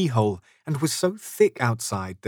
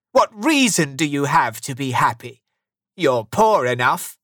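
louder scornful questioning; grumpy challenge; 4 semitones higher, 5 dB louder than usual. A narrator's reading. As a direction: scold as a cross old miser. Scrooge keeps scolding nephew.

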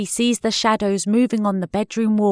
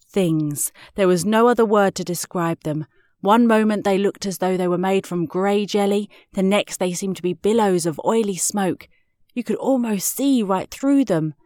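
usual speaking voice

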